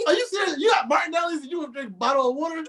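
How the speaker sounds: high, fast disbelief; you can't be serious; mock outrage. Real, not acted. Mock outrage at water toast.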